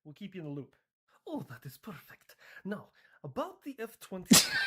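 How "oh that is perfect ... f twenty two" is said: mock Polish accent, rising higher. Poland asks about another weapon, in a mock Polish accent. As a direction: angle for more in a put-on Polish accent. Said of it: "Ah, Poland".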